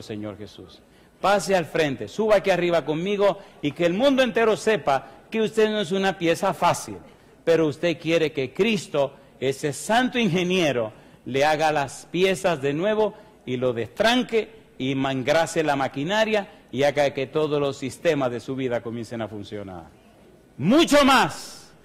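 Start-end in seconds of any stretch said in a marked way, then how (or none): 1.2-19.8 louder, driving preacher's appeal; fervent altar call. Acted. Altar call, preaching full force. 20.6-21.7 slow, high, loud preacher's cry; drawn-out shout. Acted. Climax of altar call.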